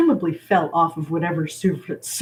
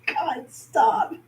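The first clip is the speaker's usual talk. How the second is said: laughing; 7 semitones higher than usual. Real, not acted. Reader cracks up mid-story.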